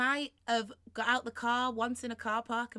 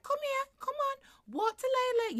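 put-on much higher, sing-song little girl coaxing; endearing toddler voice; slower than usual. A three-year-old coaxing her baby brother to walk, much higher and sing-song. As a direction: coax a baby brother like a small child. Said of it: "being all endearing".

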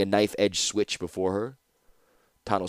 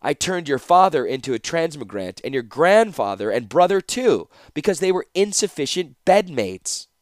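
higher, louder, clearly projected taunt; 7 semitones higher, 4 dB louder than usual. Acted. Captor tests whether the guard understands, speaking clearly. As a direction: announce it clearly for the guard to hear. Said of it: "said clearly".

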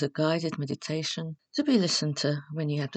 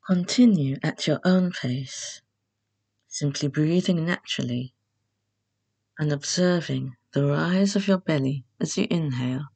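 guided meditation; 4 dB louder than usual. Acted. Host leading beginners through Yoga Nidra, calm guided meditation. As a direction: guide them unhurriedly into rest, phrase by phrase.

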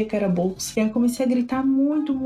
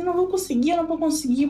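higher-pitched imitation of distress; retold protest; 7 semitones higher, faster than usual. Labouring mother to her helpers, anxious retold protest. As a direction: protest that you can't manage it, pitch lifted. Retelling her panic while being walked.

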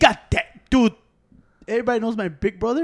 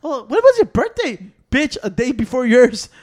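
slow, loud, higher retort; mock sassy exchange; 4 semitones higher, 7 dB louder, slower than usual. Relatives forgetting his birthday, sassy slow retort. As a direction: lean into the sass.

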